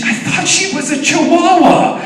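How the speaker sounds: put-on very high, loud, slow child voice; indignant little boy. Son talking as if dad's the idiot, high and indignant. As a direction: imitate a kid explaining the obvious, high and loud. Son explains to dad, exasperated.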